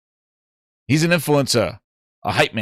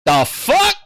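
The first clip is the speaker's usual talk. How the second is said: sudden high shocked outburst; 9 semitones higher than usual. Retold or imitated. Streamer reacting in shock that a podcast got the box, surprised. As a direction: blurt it out high in disbelief.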